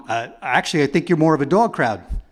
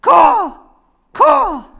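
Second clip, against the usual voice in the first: high, loud sharp crow caws; exclamatory caw; 8 dB louder than usual. Pretend. Crow demonstrating the exclamation point, loud high caw. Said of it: "the exclamation point".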